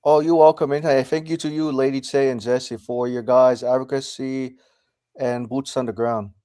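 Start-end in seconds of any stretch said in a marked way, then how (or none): none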